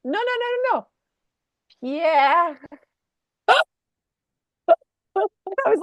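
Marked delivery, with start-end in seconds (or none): 0.0-0.9 put-on very high, rapid child protest; protesting three-year-old. Child stopping parent's tired reading, high imitated protest. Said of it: "he goes". 1.8-2.6 higher, exaggerated French Pierre; child's French accent. Three-year-old correcting parent's reading, higher French-accented Pierre. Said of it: "lean into the French accent".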